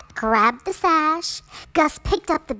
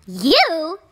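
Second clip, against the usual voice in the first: mocking disbelief; 5 semitones higher, 6 dB louder than usual. A narrator's reading. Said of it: "cried the stepsisters".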